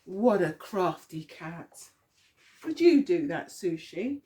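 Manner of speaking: ordinary delivery, nothing marked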